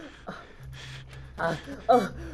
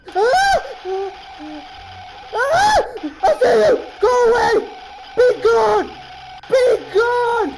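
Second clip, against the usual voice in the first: high, loud screams and commands; panicked shouting; 10 semitones higher, 6 dB louder, slower than usual. Real, not acted. Player ordering the monster away, panicked shouting. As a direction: yell to drive the thing away.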